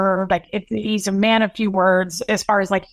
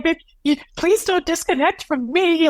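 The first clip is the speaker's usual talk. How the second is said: high, strained, tearful plea; imitating hysterical crying; 9 semitones higher than usual. Son begging grandfather on speakerphone, high tearful pleading. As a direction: beg through tears, voice pitched up. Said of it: "he was just hysterical".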